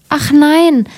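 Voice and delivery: high-pitched, lightly acted "oh no"; a touch of fretful princess; anxious denial. A narrator's reading. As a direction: deny it with mild alarm. Princess anxiously answers her father.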